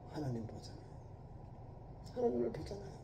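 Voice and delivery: breathy hushed whisper. Real, not acted. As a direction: drop to an awed whisper. Awed aside about seeing God. (속삭이듯이)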